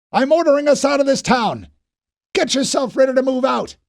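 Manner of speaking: high, loud commanding shout; yelled orders. A narrator's reading. Fisher orders Knopf to get ready to leave, loud shout. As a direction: bark the order to move out. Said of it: "he yelled".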